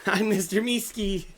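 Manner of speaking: eager little creature; Meeseeks voice. Acted.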